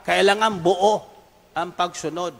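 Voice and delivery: normal talking voice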